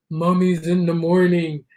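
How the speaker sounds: low spooky announcing voice; low eerie book title. Pretend. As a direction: say the title like a creepy trailer. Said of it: "why I said it in that voice".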